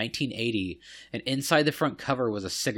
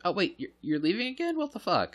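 high voiced upset dog; dog's voice; 9 semitones higher than usual. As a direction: speak as the hurt pet dog. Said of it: "he's like".

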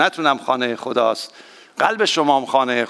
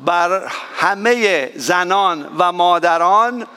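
slow, tender line for husbands to repeat; slow demo line; 3 semitones higher, slower than usual. Shown as a demonstration. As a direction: spell it out slowly so the men can echo it. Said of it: "بگید".

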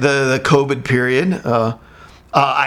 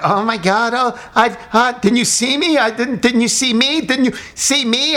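mock panic; 8 semitones higher than usual. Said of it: "she's freaking".

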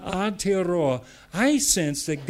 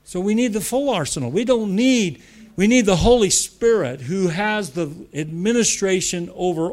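louder, higher preaching voice; full-force preaching; 4 semitones higher, 6 dB louder than usual. Real, not acted. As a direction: drive the point home to the congregation.

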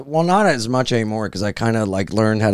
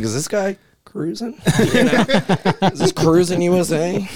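mock suspicious thought; 7 semitones higher than usual. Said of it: "I was like".

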